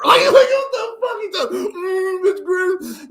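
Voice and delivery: very high, loud hammed-up wailing; mock panic. Captive protesting to kidnappers in the car, high mock wailing. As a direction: squeal in exaggerated captive panic.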